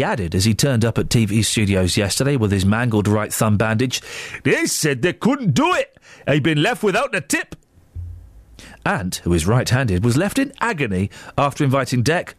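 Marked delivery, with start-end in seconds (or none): 4.4-7.6 put-on Geordie twang; Geordie accent. Radio host reading out Ant's injury quote, put-on Geordie. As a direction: play the quote in a hammed-up Geordie voice. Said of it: "That's my Geordie accent".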